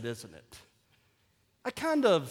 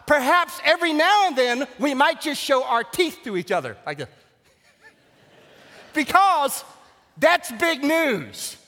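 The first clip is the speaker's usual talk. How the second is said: loud, high, exultant pulpit cry; full-force preaching; 8 semitones higher, 9 dB louder than usual. Real, not acted. Pastor exhorting Baptists over the risen Christ, full-force preaching.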